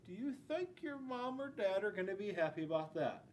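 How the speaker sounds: plain reading voice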